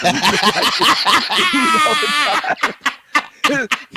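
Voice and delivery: high, helpless laughing fit; real uproarious laugh; shrieking laughter. Real, not acted. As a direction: laugh until breathless.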